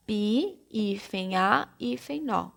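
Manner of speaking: "Pi, hífen A, hífen nó" as separate dictated pieces; syllable by syllable. Shown as a demonstration. Teacher showing piano's separate syllables, one piece at a time. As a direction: break piano into pi, a, no, naming each hyphen. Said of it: "cada letrinha fica em uma sílaba".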